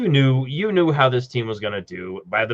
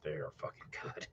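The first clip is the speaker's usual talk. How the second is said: hushed; 8 semitones lower, 23 dB quieter than usual. Real, not acted. Savoring food, hushed.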